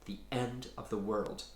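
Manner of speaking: ordinary delivery, nothing marked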